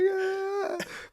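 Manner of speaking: loud, high put-on laugh; imitated War Dogs laugh. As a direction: laugh mockingly, high and drawn out. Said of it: "know the wee laugh out of War Dogs".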